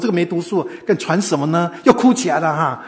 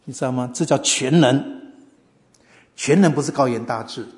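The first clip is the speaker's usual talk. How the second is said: ordinary delivery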